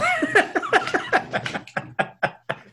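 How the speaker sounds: very high, long laugh that falls away; high-pitched laughing fit. Real, not acted. Teller laughing at the owner's comeback, squealing laugh.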